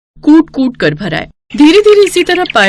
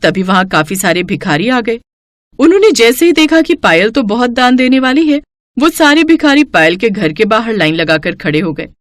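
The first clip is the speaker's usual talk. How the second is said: ordinary delivery, nothing marked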